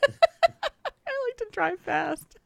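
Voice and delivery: giggled out, high and slow; laughing. Real, not acted. Host admitting she speeds, laughing. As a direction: confess it through real laughter.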